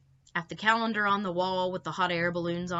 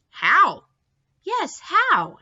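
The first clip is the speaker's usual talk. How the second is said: higher and louder eager questions; eager demand; 10 semitones higher, 10 dB louder, much slower than usual. Acted. Children pressing their father for proof, eager high questions.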